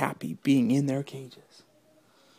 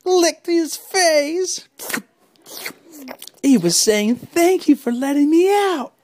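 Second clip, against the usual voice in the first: much higher, louder joy; excited squeal; 8 dB louder, slower than usual. A narrator's reading. Lion licks Ralphie's face, high happy squeal. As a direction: gush with delight.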